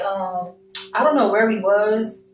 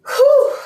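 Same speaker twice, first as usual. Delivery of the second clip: loud exhale; 6 dB louder than usual. Real, not acted. Letting out emotion after crying.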